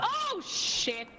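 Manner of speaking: high alarmed exclamation; startled outburst. Real, not acted. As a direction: blurt it out in shock. Dies to monster, exclaims.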